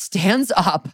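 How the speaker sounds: usual speaking voice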